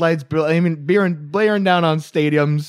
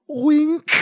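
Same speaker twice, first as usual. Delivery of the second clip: sly wink; 12 semitones higher than usual. Pretend. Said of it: "says wink aloud".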